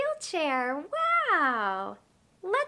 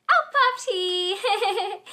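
slow, laughing Oh, Puffy; slow delighted laugh through the name; laughing surprise; 3 semitones higher, 4 dB louder, much slower than usual. Acted. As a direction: burst out laughing at the pop-up. Mouse puppet pops out.

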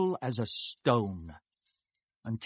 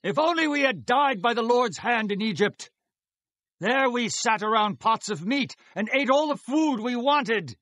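grumbling complaint; 7 semitones higher than usual. Acted. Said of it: "The Israelites said to them".

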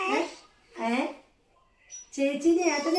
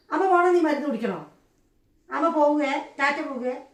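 louder, higher mock threat; mother teasing her baby that she will leave, louder; mock scolding; 3 semitones higher, 6 dB louder than usual. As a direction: playfully raise it to scold the baby.

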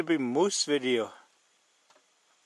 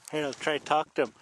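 ordinary delivery

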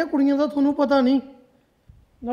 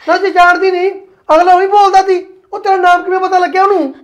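loud, higher, furious father; father yelling at his daughter in a rage; angry shout; 5 semitones higher, 10 dB louder than usual. Acted. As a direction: shout the accusation at her.

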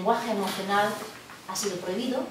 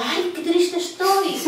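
higher, louder, slow theatrical wail of "Ay, qué triste estoy"; a lecturer parodying a showy "Ay, qué triste estoy"; mock lament; 10 semitones higher, 7 dB louder, much slower than usual. Shown as a demonstration. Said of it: "Yo no digo".

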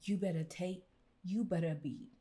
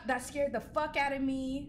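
ordinary talk, nothing marked

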